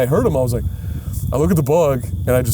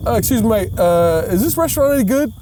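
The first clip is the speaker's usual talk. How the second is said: light mock British accent, pitched up; 6 semitones higher than usual. British guy asking about the restaurant, mock UK accent. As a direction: imitate a polite Brit lightly. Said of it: "U K accent".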